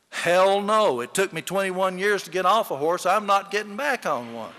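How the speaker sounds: loud, emphatic retold no; gruff loud refusal. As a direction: bark it out like an old farmer done with horses. Old man refusing horse riding.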